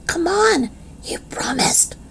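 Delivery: unvoiced pleading whisper. Girl urges friends to keep their promise, pleading whisper. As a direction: plead under your breath. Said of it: "she whispered".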